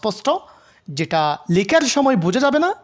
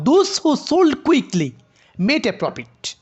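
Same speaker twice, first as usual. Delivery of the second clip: "sold quickly" lifted together as one raised phrase; rising intonation demo; 4 semitones higher, much slower than usual. Shown as a demonstration. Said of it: "rising করলাম কোথায়, কোথায়? Sold এবং quickly একসাথে".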